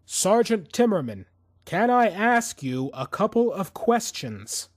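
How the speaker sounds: raising his voice at a man who won't answer. A narrator's reading. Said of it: "Alex raised his voice".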